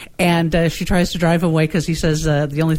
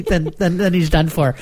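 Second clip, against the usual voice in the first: usual speaking voice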